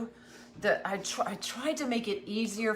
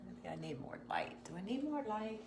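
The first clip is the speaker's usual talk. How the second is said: breathy near-silent whisper to herself; 10 dB quieter than usual. Real, not acted. Host whispering to herself about the light, hushed whisper. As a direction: whisper it, barely voiced. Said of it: "I don't know why I'm whispering".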